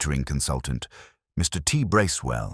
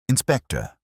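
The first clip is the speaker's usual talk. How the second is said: high, bright and a touch louder; bright greeting; 9 semitones higher, 3 dB louder than usual. Acted. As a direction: greet the inspector with bright eagerness. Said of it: "she said brightly".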